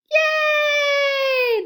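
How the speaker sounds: high, loud joyful yay; cheering yell. A narrator's reading. Cubs throwing caps in the air, high loud cheer. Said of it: "the cubs all yelled".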